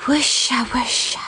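soft rustling whisper; whispering trees. Acted. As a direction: breathe it like wind in leaves. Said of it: "said the trees".

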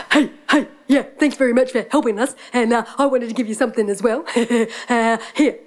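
fast, panting dog voice; rushed words with panting laughs; eager, friendly dog. Acted. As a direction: chatter like an excited dog.

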